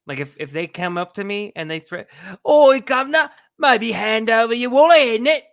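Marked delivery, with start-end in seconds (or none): 2.5-5.4 loud, high mock British gangster; shouted caricature of a thick British accent. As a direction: threaten like a hammed-up British thug. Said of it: "thick British accents".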